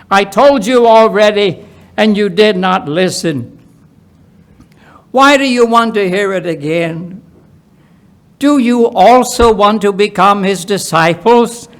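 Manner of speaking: exasperated healed man. Acted. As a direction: push back, fed up and a little mocking.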